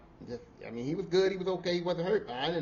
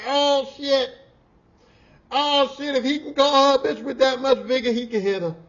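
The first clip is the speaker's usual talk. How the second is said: alarmed; 9 semitones higher, 10 dB louder than usual. Retold or imitated. Alarmed realization.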